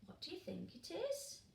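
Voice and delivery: usual speaking voice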